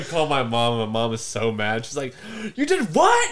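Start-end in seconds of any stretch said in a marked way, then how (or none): 2.5-3.3 high, loud scolding disbelief; mock angry mom. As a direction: shriek it like an outraged mother.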